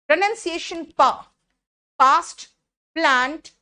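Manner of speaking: ordinary talk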